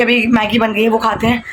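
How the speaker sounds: normal talking voice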